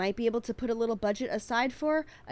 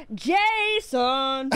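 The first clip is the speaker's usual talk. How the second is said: loud, drawn-out mock yell; mock shout; 4 semitones higher, 7 dB louder than usual. Actor retries the line, yelling Jason. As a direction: stretch the name out loud like a bad actor. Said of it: "Tried to say it like".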